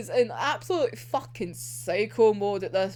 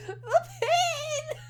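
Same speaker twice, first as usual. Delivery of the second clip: laughing anguish; 4 dB quieter than usual. Real, not acted. Reacting to a sad scene.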